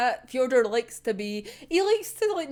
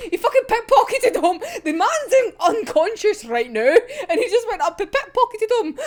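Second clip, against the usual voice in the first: faster, louder disbelieving recap; outraged excitement; 7 semitones higher, 5 dB louder than usual. Real, not acted. Fan recapping the villain's theft, fast and loud in outrage. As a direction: rush it out in outrage.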